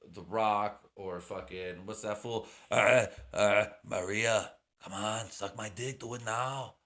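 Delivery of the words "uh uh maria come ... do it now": rough mock Austrian-accented action star; Arnold Schwarzenegger. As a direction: put on a goofy Austrian accent, opening with loud grunts. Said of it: "Arnold, Arnold, there we go, Arnold Schwarzenegger".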